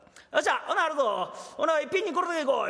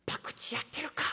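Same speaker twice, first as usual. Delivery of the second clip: secretive whisper indoors; 8 dB quieter than usual. Acted. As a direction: hush it, barely voiced. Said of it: "中で小さい声出して". (ひそひそ声でささやく)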